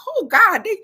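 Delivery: put-on aunt hearing kids curse, scandalized; shocked gasp. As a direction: clutch your pearls. Listening to the kids cuss.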